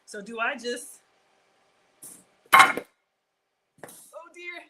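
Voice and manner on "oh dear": startled. Real, not acted. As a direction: gasp in alarm. Loaf flip moment.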